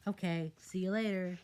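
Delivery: put-on high bright kid farewell; chirpy kid voice. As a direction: say bye like a little kid. Child says goodbye.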